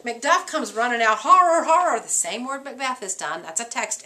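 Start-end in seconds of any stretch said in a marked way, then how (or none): 1.2-2.0 high, loud cry of horror; shouted horror. Retold or imitated. Teacher voicing Macduff to class, a loud horrified cry.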